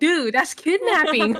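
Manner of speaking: mock outraged dude; incredulous retort. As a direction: call it out loudly. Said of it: "And I'm like".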